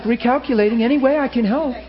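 a lightly voiced loving GPS; sweet GPS voice. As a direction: coo it like a gracious sat-nav. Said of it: "She is so sweet".

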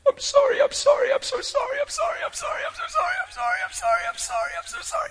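imitated sobbing apology. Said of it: "She was crying so hard".

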